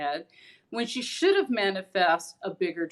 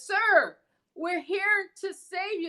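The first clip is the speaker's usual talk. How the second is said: rescue crew call; 11 semitones higher, slower than usual. Retold or imitated. Big boat's crew telling the man they will save him, higher and slow. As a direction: announce help to the man in the water. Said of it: "the boat says".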